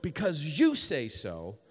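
"you" lifted loudly in a slow phrase; stress on YOU. Shown as a demonstration. Believer with unanswered prayers yielding to God, stress on "you". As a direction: slowly build onto a strong "you". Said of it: "Emphasis on you".

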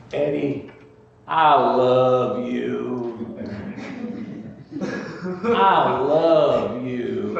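very slow, drawn-out Ozark drawl. Retold or imitated. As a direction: drag out the Ozark drawl, tenderly. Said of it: "he had this Ozark drawl".

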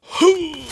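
high, loud offended hoot; sulky hoot. A narrator's reading. The pigeon huffs at Alice, a sulky high hoot. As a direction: huff like a sulking pigeon. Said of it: "in a sulky tone".

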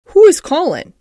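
usual speaking voice

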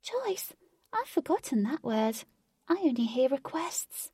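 high, small and puzzled; a fairy who forgot what choice means; high sad fairy voice; wistful fairy. A narrator's reading.

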